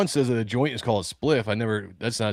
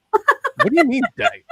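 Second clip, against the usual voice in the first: baffled; 5 dB louder than usual. Retold or imitated. Retold baffled parent's reply.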